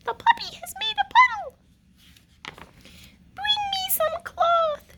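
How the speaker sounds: a queen fussing over a mess; flustered queen. Acted. Queen calling to the chambermaids, high and fussy.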